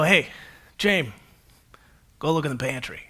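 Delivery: grandma's instruction. Retold or imitated. Grandma sending grandson to the pantry, low and knowing. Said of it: "she'd go".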